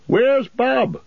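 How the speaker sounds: high, bustling landlord voice; a cheery host bending down to greet; jolly innkeeper. A narrator's reading. Landlord asks Nob.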